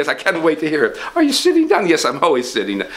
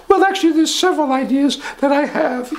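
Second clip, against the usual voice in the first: higher pompous rich man; 11 semitones higher than usual. Acted. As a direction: puff up as the rich boss.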